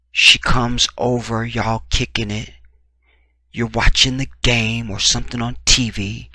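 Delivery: ordinary delivery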